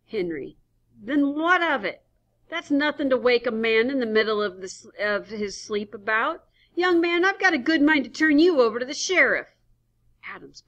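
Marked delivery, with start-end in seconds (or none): 1.0-9.5 irritated scolding. Acted. Henry scolding the stranger.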